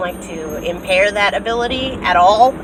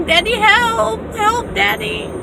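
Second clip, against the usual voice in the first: high pleading cry of help; playful, high, urgent Daddy, help; mock panicked plea; 13 semitones higher, slower than usual. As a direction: beg for help in a hammed-up panic. Reenacting her panicked call.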